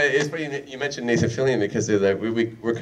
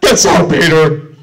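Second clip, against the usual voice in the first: loud slow mock Deep Roy line; hammed-up Deep Roy, laughing; Deep Roy impression; 5 semitones higher, 11 dB louder, slower than usual. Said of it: "Deep Roy impression".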